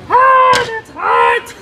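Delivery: sharp yelp of pain, high and loud; burnt fingertips. Real, not acted. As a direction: yelp at scalding heat. Burns fingers on hot pot.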